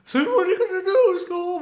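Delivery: very high, loud cartoon voice; Shaggy from Scooby-Doo. Demonstrating first part of a combined impression, Shaggy voice. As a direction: push it high and loud, goofy cartoon sidekick. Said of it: "First of all, you've gotta do the".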